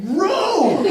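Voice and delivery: imitated rowing command, bellowed high and loud; hammed-up high shout; Maggie. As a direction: yell it across the raft like a teammate. Imitates Maggie yelling on the raft.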